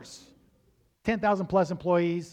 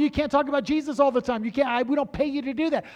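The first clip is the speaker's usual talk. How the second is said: bosses scolding; 8 semitones higher, 6 dB louder, faster than usual. Retold or imitated. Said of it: "they told me".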